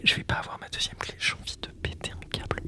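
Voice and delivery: fast frustrated whisper; real exasperated whisper. Real, not acted. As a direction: mutter it under your breath, fast.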